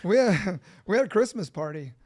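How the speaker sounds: loud, talking through a laugh; real amused laugh breaking into the words. Real, not acted. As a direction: laugh while restarting the story. Laughing at the interjected joke.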